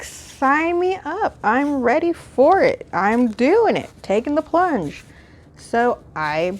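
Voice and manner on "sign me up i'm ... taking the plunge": higher, drawn-out gleeful "sign me up"; mock eager excitement. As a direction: play it like diving in headfirst. Acting out eager decision to buy.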